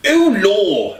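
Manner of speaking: put-on high, loud, startled young man; young man reacting to the blast; young man's exclamation. A narrator's reading. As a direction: blurt it out in alarm. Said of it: "a younger male voice".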